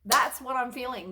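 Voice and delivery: normal talking voice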